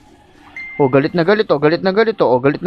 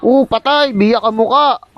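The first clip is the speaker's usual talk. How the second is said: excited cheering; 8 semitones higher than usual. Real, not acted. Gamer cheers his team during a fight, excited and high. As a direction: whoop it up, voice jumping high. Cheering a teammate's kill mid-game.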